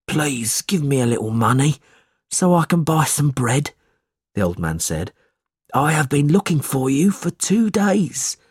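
put-on old man's plea; begging old man. A narrator's reading. Hungry old man asking Ralph for money, begging. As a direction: beg in a needy old man's voice.

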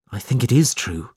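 plain reading voice

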